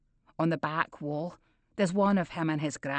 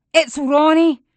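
loud angry shout; 8 semitones higher, 10 dB louder than usual. A narrator's reading. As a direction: yell it out. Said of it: "I shout".

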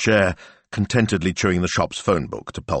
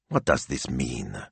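slow, low, soft and bewildered; dazed; 6 semitones lower, 7 dB quieter, slower than usual. A narrator's reading. Boss poring over phone messages, dazed. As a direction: murmur it slowly, lost in confusion.

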